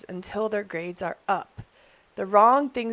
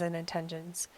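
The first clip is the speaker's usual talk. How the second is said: usual speaking voice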